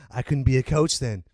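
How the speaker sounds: ordinary talk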